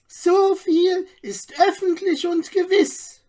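high, shrill-edged gossip, full of herself; piercing self-important voice. A narrator's reading. Gossip Margaret answers the crowd, piercing and self-important. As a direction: announce it like the town's know-it-all. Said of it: "nahm wieder die Eibisch Margret das Wort".

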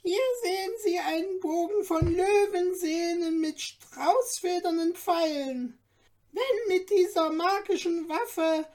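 high, eager little dwarf guide; courteous little dwarf. A narrator's reading. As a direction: play the tiny helpful dwarf showing off treasures. Dwarf presents the bow.

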